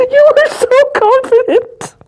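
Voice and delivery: very high, laughter-strained voice; laughing hard. Real, not acted. Co-host teasing her confidence, helpless with laughter. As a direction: squeeze words out through laughter.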